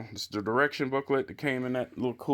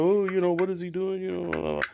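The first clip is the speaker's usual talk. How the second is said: higher, louder mocking busybody voice; mock critics; 7 semitones higher, 3 dB louder, faster than usual. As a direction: mimic nagging commenters, pitched up. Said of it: "people coming in talking about".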